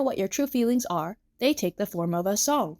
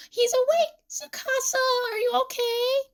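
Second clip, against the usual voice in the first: very high, excited concern; high girlish call of relief and worry; Emu. A narrator's reading. Emu checks on waking Tsukasa, high and eager.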